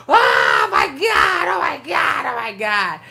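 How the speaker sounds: drawn-out high-pitched mock wail; hardest cry. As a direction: imitate a boy screaming and crying hard. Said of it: "crying the hardest cry you ever hear".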